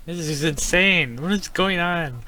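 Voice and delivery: high, loud, drawn-out exclamation; exasperated disbelief. Real, not acted. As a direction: stretch it out in bewilderment. Baffled reaction to game events.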